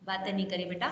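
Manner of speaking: soft, low aside to the pupils; quiet aside. Real, not acted. Teacher quietly tells a chatting child to stop talking. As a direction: hush them gently under your breath.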